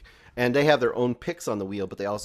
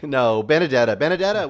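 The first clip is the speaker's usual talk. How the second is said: 1 loud correction; 4 semitones higher, 9 dB louder than usual. Real, not acted. Correcting the pick loudly.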